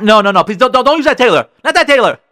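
high, loud, urgent protest; not nice, loud panicky refusal; frantic objection. Acted. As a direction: snap at him to stop. Acts wife objecting, contrasted with nicely.

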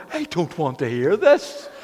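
laughing, slow, mock-pained protest. Pastor to hairdresser, laughing mock protest. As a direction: play up the embarrassment with a chuckle. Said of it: "and I'm going".

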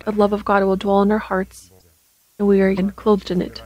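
ordinary talk

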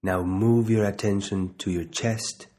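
slow, measured meditation guide with long pauses; unhurried body-scan instruction, leaving space to breathe; guided meditation. Real, not acted.

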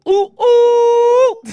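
high, loud whooping cry; excited whoop. Real, not acted. Cohost greeting the show with a high, loud whoop. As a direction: whoop like the crowd's going wild.

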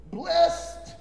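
high, loud, ringing Blessed; Blessed flung out high and loud like a cheer; shouted blessing. Shown as a demonstration. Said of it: "you gotta say it just like that".

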